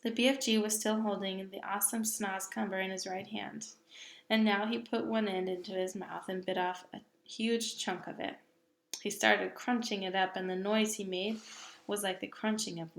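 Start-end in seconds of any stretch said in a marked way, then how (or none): none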